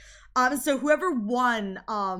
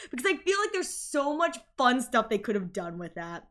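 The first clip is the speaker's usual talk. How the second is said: usual speaking voice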